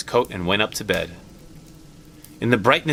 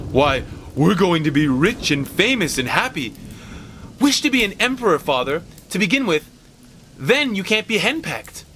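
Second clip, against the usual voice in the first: son hams up a playful alarm, higher; mock horror, teasing son; 7 semitones higher than usual. Lightly voiced teasing young man. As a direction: Herbert teasing his father about the wish, in mock horror. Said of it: "with pretend horror".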